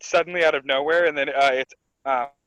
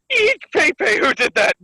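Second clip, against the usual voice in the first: high, louder mock squeal; hammed-up high-pitched fright; startled squeal; 10 semitones higher, 4 dB louder, slower than usual. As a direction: squeal it like a startled cartoon character. Acting Ulti's startled question.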